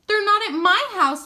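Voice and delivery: panting between words; breathless from running; out of breath. A narrator's reading. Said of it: "panted Alice".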